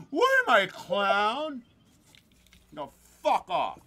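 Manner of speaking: high, loud "what am I, a clown" bit; hammed-up mock outrage at being laughed at; mock offended.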